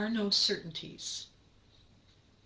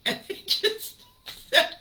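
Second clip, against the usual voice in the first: laughing; 12 semitones higher, 7 dB louder, slower than usual. Real, not acted. Laughing mid-story.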